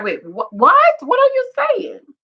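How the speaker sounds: mock shock, leaping high on WHAT. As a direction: imitate a stunned husband in a high, incredulous voice. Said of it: "He is shocked. His face is like".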